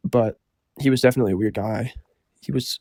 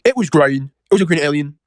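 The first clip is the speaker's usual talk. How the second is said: Dave's voice; 4 semitones higher, 6 dB louder than usual. Retold or imitated. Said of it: "So he goes like".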